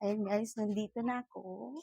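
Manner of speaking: far from the mic. Real, not acted. Vlogger checking if viewers still hear her, far from the mic. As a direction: speak from a distance, testing the mic's reach. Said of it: "nasa malayo ba ako".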